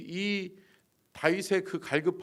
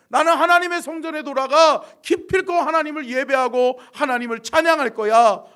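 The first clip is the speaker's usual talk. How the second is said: loud, high declamation; rally yourself with a forceful declaration; impassioned resolve; 10 semitones higher, 13 dB louder than usual. Acted. David resolving to return and praise God, impassioned.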